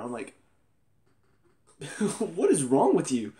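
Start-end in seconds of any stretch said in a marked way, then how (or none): 2.2-3.3 exasperated scolding. Acted. Scolding the cold wife.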